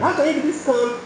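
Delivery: high-pitched, exasperated imitation; retold anger. Angry passenger questioning the driver's calm, retold in high exasperation. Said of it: "He was still angry".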